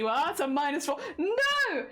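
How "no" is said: shocked no. Real, not acted. Protesting a guilty verdict.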